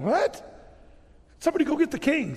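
the men of Gath spotting David at the gate; alarmed guards. Retold or imitated. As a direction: call out in alarm from the wall.

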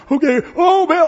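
high, loud mock shriek to Baal. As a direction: overdo the prophets' desperate cry. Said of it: "they shriek the louder".

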